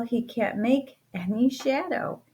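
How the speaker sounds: plain reading voice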